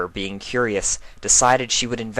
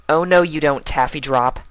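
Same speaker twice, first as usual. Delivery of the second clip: put-on higher firm mare voice stopping a child; 7 semitones higher, 5 dB louder than usual. Twilight stopping Taffy Drop, firm and higher. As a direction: catch her before she goes.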